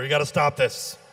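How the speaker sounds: ordinary delivery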